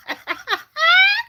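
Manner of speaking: a high, squealing laugh at her own joke; tickled by her own punchline; high cackle. Real, not acted. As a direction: break into a high giggle.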